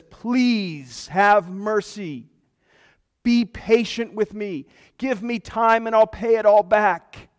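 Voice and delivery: high, slow, begging servant on his knees; desperate plea. Acted. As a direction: plead for your life, stretching the words. Servant falls down and pleads.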